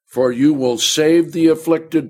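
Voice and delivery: loud, high reading of the verse; preaching scripture. Acted. Preacher proclaiming the verse to the church, loud and high. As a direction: declaim each line to the church.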